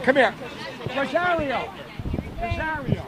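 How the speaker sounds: calling out. Real, not acted. Organizer calling a woman over for an interview, calling out. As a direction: call someone over from a distance.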